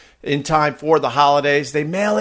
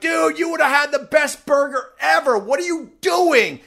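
high, shouting scold; high mock shout of disbelief; mock outraged shout; 10 semitones higher than usual. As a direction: yell at him like a disappointed butcher. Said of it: "he's like".

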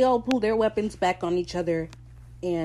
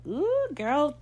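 high, drawn-out gossipy reaction; scandalized; 8 semitones higher than usual. Retold or imitated. Fan reacts to Mary's look, scandalized. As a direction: relish the mess with a high "ooh".

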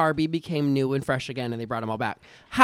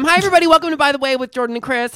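podcast host; 7 semitones higher, 4 dB louder, much faster than usual. Acted. Podcast intro announcement.

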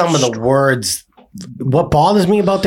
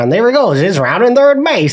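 high-pitched vintage play-by-play voice; old-time American broadcaster; old-timey radio announcer; 7 semitones higher than usual. Retold or imitated. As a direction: imitate an old radio baseball call. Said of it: "Like that old radio voice".

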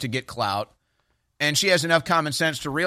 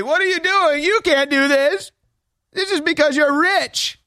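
mock outraged quote; 12 semitones higher, 3 dB louder than usual. Host mimicking media attacking Barry Weiss, squealing mock outrage. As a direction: parody the indignant media crowd. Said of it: "and then they say".

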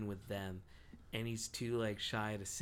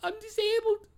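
high, loud squeaky panic; scared panicky voice; 12 dB louder than usual. Retold or imitated. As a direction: blurt it in high panicked fright. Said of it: "he gets all scared".

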